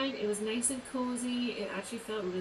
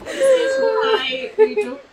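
high, loud mock wail; 13 semitones higher, 15 dB louder, slower than usual. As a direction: wail it out over the top. Bursts out mid-joke.

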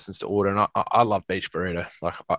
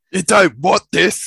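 slow, loud, higher mock threat; mock tough guy; 8 semitones higher, 9 dB louder, slower than usual. Friend jokingly playing the hard man from the fight story, a slow loud mock threat. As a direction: drag it out like a hammed-up tough guy.

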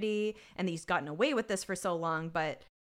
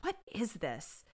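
getting upset; 2 semitones higher, 5 dB quieter, slower than usual. Retold or imitated. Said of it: "He starts to get upset".

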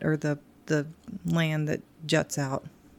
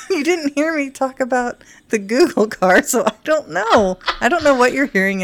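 exasperated; 7 semitones higher, 7 dB louder than usual. Real, not acted. Teasing exasperation at partner.

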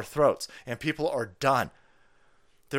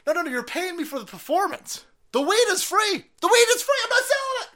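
very high, loud wail; mock frantic protest; 5 dB louder than usual. Weed seller insisting to cop it's free, mock frantic pleading. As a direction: climb higher and louder, absurdly pleading.

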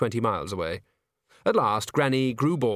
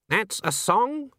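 indignant; 11 semitones higher, slower than usual. Acted. Swan indignant.

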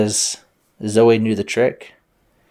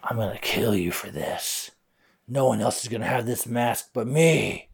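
menacing threat; 7 dB quieter than usual. Acted. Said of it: "he's like".

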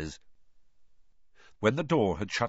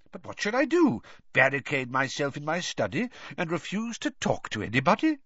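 put-on higher, emphatic old headmaster; Dumbledore, exasperated; 7 semitones higher than usual. A narrator's reading. Headmaster citing complaints about himself, exasperated. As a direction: argue with rhetorical exasperation.

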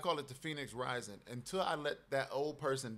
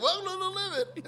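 much higher welcoming pitch, a bit louder; high greeting voice; 7 dB louder than usual. Shown as a demonstration. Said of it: "I can't even do the voice anymore".

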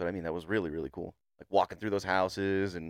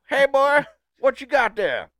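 very high, loud old-man imitation; mock holler; 10 dB louder, slower than usual. Listener mimicking old man calling boy, hammed-up shout. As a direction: exaggerate the nosy old man's holler.

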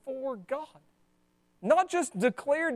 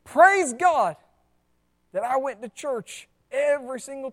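self-congratulating praise; 7 dB louder than usual. Retold or imitated. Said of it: "They're not saying".

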